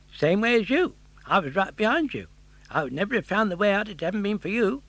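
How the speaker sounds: high, quick chatty fish; cheerful tunny, rattling on; friendly fish voice. Acted.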